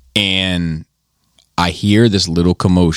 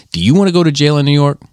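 slightly raised, quick inner-voice question; little inner voice; 5 semitones higher, 3 dB louder, faster than usual. Retold or imitated. Conscience warning a man about to fight, lightly voiced inner voice. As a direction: lightly voice a nagging thought, quickly. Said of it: "this little voice pop up".

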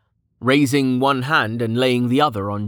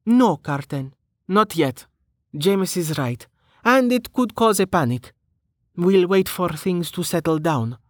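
higher-pitched female character voice; light, higher voice, lightly softened; soft-spoken reply; 7 semitones higher than usual. Acted. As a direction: gently decline in a lifted voice. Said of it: "then spoke softly".

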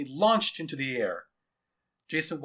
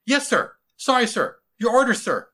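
high, loud military replies; soldier at attention; 10 semitones higher, 11 dB louder than usual. Acted. Lieutenant answering his general after a scolding, snapped to attention. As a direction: answer like a chastened recruit. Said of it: "Gerald snapped to attention".

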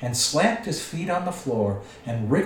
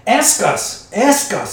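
high, loud creature demand; demanding Gollum; 9 semitones higher, 10 dB louder, slower than usual. A narrator's reading. Gollum pestering Bilbo for a riddle, demanding. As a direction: cry it out high and insistent as Gollum.

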